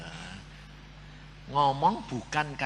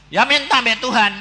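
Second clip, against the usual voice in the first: loud, high exhortation; emphatic retort; 8 semitones higher, 9 dB louder than usual. Retold or imitated. Pastor tells the childless man to pray, loud emphatic retort. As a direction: throw the obvious advice back at him forcefully.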